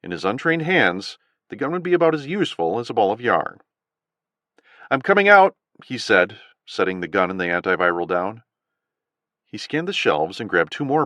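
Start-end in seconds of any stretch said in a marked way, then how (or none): 4.9-5.6 higher, louder call to the robbers. A narrator's reading. Adam calling to the gunmen, raised call. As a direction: call out to show you are unarmed.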